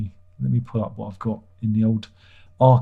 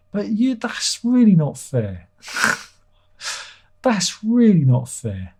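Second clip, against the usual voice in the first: mock protest; 10 semitones higher, slower than usual. Mock protest at hard question.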